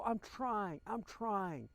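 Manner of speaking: replaying own frustrated outburst, mildly; retold anger. Husband losing it with his sick wife, retold mild anger. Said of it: "I would get angry with her".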